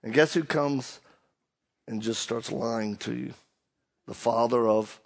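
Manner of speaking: ordinary talk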